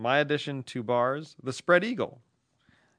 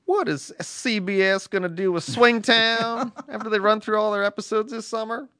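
Southern female voice; 7 semitones higher, 4 dB louder than usual. Acted. Relaying a wife's TV question from a letter, Southern female voice. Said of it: "that was your Southern f-, female voice".

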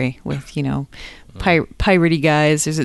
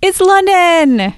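high, loud, drawn-out cheer; slow singsong shout of delight; mock-excited announcement; 14 semitones higher, 7 dB louder, slower than usual. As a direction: belt it out like a playful fanfare. Playful answer to the reveal.

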